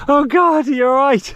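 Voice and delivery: high, loud concerned call; worried cry. Real, not acted. Hiker calling to his fallen dog in real concern. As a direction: rush out the worry for the dog.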